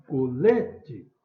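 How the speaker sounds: LE punched loud in Colete; calling out. Shown as a demonstration. Teacher marking the tonic syllable, stressed LE in Colete. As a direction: hit the middle LE of "Colete". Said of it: "chamar ela mais forte".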